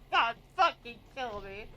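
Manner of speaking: words squeezed out between laughs; embarrassed laughter. Real, not acted. Mortified streamer to chat, laughing helplessly. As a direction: groan it through helpless giggles.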